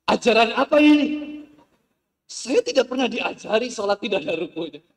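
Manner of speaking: high, very loud indignant yell; outraged shout. Retold or imitated. Ex-thug convert yelling at the congregation mid-prayer, furious shout. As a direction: bellow it in outrage across the mosque. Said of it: "Langsung dia teriak di masjid".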